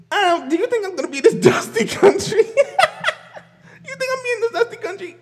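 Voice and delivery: high, laughing mock question. Imagining herself being called dusty country, laughing. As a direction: scoff at the notion through laughter.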